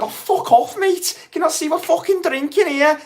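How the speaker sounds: high-pitched Scouse heckler brushing someone off; Scouse accent. Retold or imitated. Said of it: "That's my Scouse accent".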